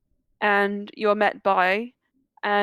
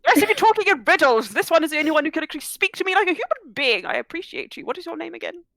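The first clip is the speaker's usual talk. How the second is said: put-on very old man; 6 semitones higher, faster than usual. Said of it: "I'm old, speak up".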